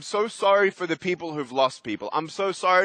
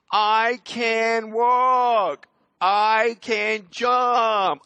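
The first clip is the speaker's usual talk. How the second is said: proud slow shout; 4 semitones higher, much slower than usual. Real, not acted. Speaker to audience on camera, proud slow shout. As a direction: declare it slowly, triumphant. Shows his foot on camera.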